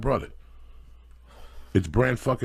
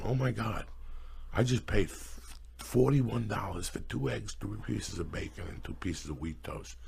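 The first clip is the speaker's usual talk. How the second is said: stunned muttering; 2 semitones lower, 9 dB quieter than usual. Acted. Stunned diner totting up a room service bill, muttering. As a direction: mutter it to yourself, sinking softer as you list.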